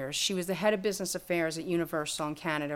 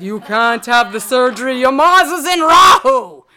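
mock pronouncement, louder and higher; astrologer voice; 4 semitones higher, 15 dB louder than usual. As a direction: boom out the verdict like the old guru.

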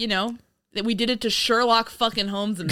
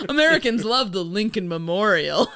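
ordinary delivery

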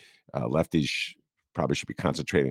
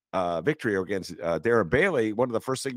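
ordinary talk, nothing marked

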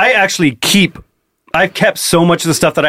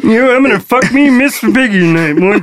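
Kermit impression; 5 semitones higher than usual. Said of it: "How's that Kermit impression?".

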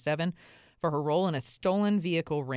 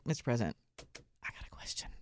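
whispered aside; 5 semitones lower, 8 dB quieter than usual. Pretend. Asking the president at his table, whispering. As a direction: drop to a hushed, breathless whisper. I'd be like.

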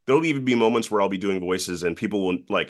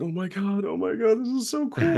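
much higher giddy voice; starstruck excitement; 12 semitones higher than usual. Retold or imitated. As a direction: gush like a thrilled kid. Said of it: "I was like".

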